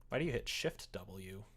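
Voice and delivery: usual speaking voice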